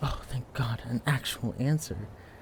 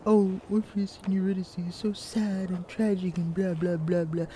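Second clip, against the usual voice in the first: higher, louder mock-whiny voice; mocking other people; 7 semitones higher, 4 dB louder than usual. Librarian mocks students who call the myth too tragic. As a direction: parody the bored complainers. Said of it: "people ignore because".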